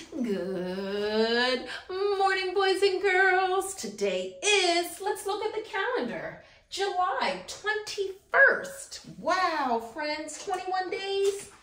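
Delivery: bright, singsong circle-time teacher voice; earnest upbeat school lecture. Acted. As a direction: greet the class like a cheerful kindergarten teacher. Circle time calendar greeting.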